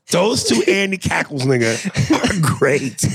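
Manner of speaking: high-pitched laughing through the words; cracking up while praising the cackles. Real, not acted. Host laughing to his co-host about favourite bits, high laughing voice.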